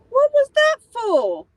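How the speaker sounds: high startled squeal. Real, not acted. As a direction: yelp it out in shock. Startled by a sudden noise.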